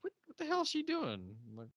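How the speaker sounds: squeaky high disbelief; high mock confusion at the screen; baffled. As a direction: ask it in shrill confusion. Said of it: "I'm like".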